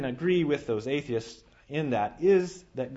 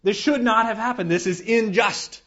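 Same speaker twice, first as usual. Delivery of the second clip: outraged protest; 8 semitones higher, 6 dB louder than usual. Retold or imitated. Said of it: "we should be outraged".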